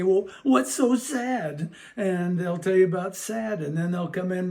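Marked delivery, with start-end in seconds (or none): 0.0-1.7 high, slow, louder put-on sympathy; playful sympathy. Adult joins a child pretending to be sad, exaggerated sympathy. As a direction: draw it out with exaggerated concern for a child.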